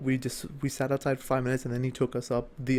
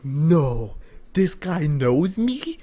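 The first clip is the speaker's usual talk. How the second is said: slow, stunned question; disbelief; 2 semitones higher, 5 dB louder, much slower than usual. Retold or imitated. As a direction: ask it in disbelief. Retold disbelief.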